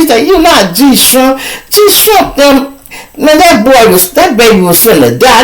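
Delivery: mock-scold him like a fed-up auntie; lightly voiced exasperated friend. Friend scolding the man, incredulous.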